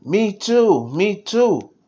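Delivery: high-pitched, keen little cry; eager exclamation. A narrator's reading. Little Toby wanting to copy Daddy, excited exclaiming. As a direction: pipe up eagerly, voice lifted high. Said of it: "Toby exclaimed".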